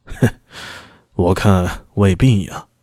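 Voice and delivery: slow, cold scornful chuckle; cold sneering laugh. A narrator's reading. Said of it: "发出一阵冷笑".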